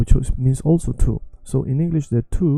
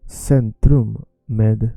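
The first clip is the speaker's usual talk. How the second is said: slow demo; much slower than usual. Shown as a demonstration. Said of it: "slow reading of a sign".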